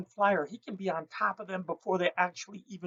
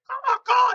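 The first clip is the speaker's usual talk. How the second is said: loud high cawing like a crow; crow caw imitation; 9 dB louder than usual. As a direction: caw sharply like an alarmed crow. Crow calls an alert.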